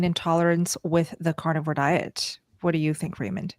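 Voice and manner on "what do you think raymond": usual speaking voice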